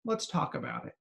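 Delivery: softly imitating a child letting go; sigh, then give in quietly; deflated. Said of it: "watch his body deflate".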